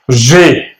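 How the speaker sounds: French G as "जे"; French letter name. Shown as a demonstration. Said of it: "ये थोड़ा different है". (French G को "जे" बोलें)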